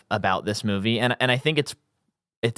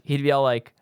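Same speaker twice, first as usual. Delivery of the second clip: ordinary talk, nothing marked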